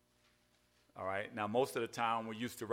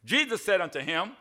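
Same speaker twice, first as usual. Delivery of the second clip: scripture reading; 4 semitones higher, 7 dB louder than usual. Acted. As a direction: read the verse out to the church. Reads scripture aloud.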